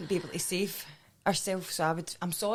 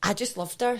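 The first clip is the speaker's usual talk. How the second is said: ordinary talk, nothing marked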